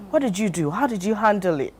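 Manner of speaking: ordinary talk, nothing marked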